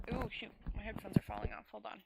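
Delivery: usual speaking voice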